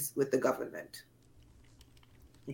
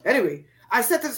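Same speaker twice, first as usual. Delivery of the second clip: ordinary talk, nothing marked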